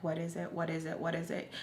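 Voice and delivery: puzzling it out under her breath; thinking aloud softly. Retold or imitated. Racking her brain before mom, soft murmur.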